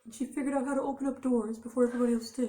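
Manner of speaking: high-pitched smart cat voice; precise, high character voice for a clever cat; Misty. Acted. Voicing the smart cat who opened doors, high voice. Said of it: "she talked like this".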